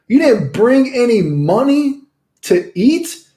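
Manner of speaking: high, incredulous retold rant at the freeloaders; exasperated disbelief. Ranting at freeloading friends, retold.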